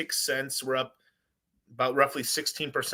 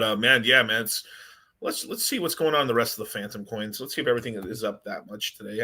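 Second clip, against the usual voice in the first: usual speaking voice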